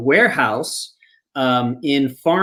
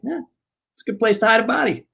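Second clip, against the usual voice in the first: fast, higher, casual toss-off; quick deadpan blurt; 7 semitones higher, much faster than usual. Retold or imitated. Teller quoting Mike to his face, fast deadpan. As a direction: rattle it off like nothing.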